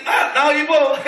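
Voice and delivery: normal talking voice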